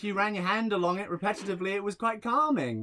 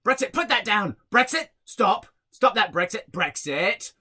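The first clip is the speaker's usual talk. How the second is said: raised call for the dog, scolding; 4 semitones higher, 5 dB louder than usual. Retold or imitated. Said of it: "he turns around and he goes".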